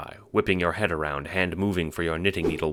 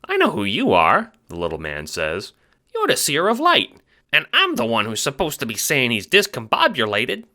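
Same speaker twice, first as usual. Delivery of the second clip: high squeaky Italian-American chipmunk; fast-talking little mobster, squeaky; Ace, chipmunk wiseguy; 7 semitones higher, 5 dB louder than usual. Acted. Little guard talks to the Seer, squeaky wiseguy.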